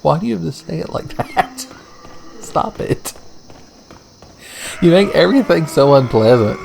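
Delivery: high, whiny protest; hammed-up whining; mock whiny complaint. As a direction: complain like a fussy kid. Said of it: "I'm like".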